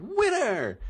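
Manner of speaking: very high, loud announcer shout; mock announcer. Imitating the site declaring the winning bug, loud high announcer shout. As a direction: belt out the winner call like a ring announcer. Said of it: "And be like".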